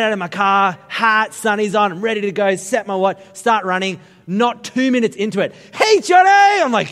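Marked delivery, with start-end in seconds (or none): 5.7-6.6 high, loud shouted greeting; big drawn-out shout of recognition; calling out. Retold or imitated. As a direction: holler the name to someone spotted in a crowd. Someone spots him and calls out.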